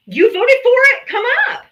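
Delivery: high, loud taunting call; taunting shout. Real, not acted. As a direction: call them up with a jeering yell. Taunting voters to come up.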